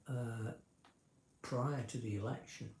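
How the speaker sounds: ordinary talk, nothing marked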